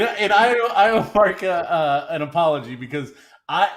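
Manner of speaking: ordinary talk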